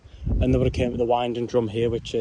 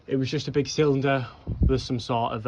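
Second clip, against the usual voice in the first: ordinary talk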